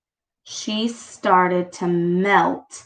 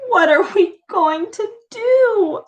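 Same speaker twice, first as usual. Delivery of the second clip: high, desperate plea; mother crying out over her daughter, high and desperate; anguished cry; 10 semitones higher than usual. A narrator's reading. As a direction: cry out in despair. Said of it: "cried Missus Cream".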